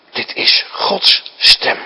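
a slow, breathless whisper of realisation; awed whisper. Acted. Eli realising it is God's voice, slow hushed whisper. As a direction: hush it, as if the truth just dawned.

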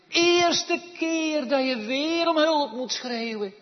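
loud, high, fast pulpit cry; full-force preaching. Acted. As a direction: drive the point home to the congregation.

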